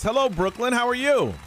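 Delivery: ordinary delivery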